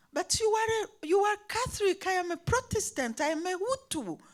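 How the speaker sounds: high, rapid insistent retort; heated retort. Retold or imitated. Pupil arguing back at her teacher, high and fast.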